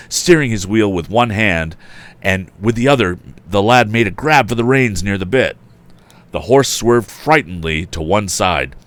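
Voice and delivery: plain reading voice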